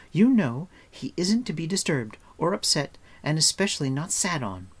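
bossy, high-pitched reprimand; scolding girl. A narrator's reading.

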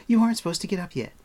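put-on high, loud, quick girl's warning; brisk, high-pitched caution. A narrator's reading. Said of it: "Eilonwy cautioned".

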